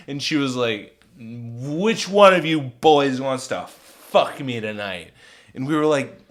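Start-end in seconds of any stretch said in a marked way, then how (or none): 1.2-5.1 slow, loud, slurred come-on; slow, heavy drunken demand; insistent drunk woman. Retold or imitated. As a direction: drag it out loudly like a pushy drunk. Drunk woman insists a second time.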